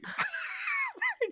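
sudden high shrieking laugh; real high laugh breaking out; burst of laughter. Real, not acted. Laughs at the preacher's punchline.

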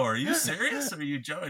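high, rising disbelief; incredulous. Retold or imitated. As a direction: ask in amused disbelief. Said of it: "And I go".

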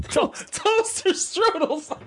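laughing. Pretend. Mocking the line while laughing.

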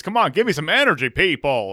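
Brody Stevens impression. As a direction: pump up the crowd like an announcer. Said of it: "I'm taking some Brody Stevens".

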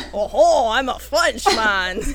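slow, loud hammed-up French accent. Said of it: "so I'm not doing this as, like, a".

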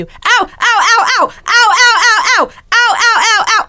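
high, loud, crying ows, over and over; imitated pained cries. Retelling wife's midnight cramp, loud crying ow ow. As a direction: mimic a partner yelping from a leg cramp. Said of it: "You were so loud".

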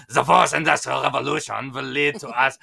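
imitating a German accent, lightly; a loose German accent impression. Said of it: "my, my German accent is not perfect".

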